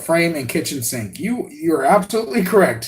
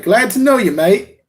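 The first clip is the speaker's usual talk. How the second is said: put-on higher-pitched, Aussie-tinged English accent; mock English accent; 6 semitones higher, 4 dB louder than usual. Streamer shows viewers the greeting he attempted, in mock English accent. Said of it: "like, an English accent".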